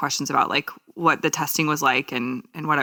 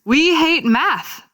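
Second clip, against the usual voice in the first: mock whine; 10 semitones higher, much slower than usual. Reading funny headline.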